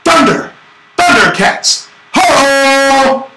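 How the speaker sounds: hammed-up kid's Thundercats cry; Thundercats battle cry. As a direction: shout it like a cartoon hero calling his powers. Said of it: "And I said".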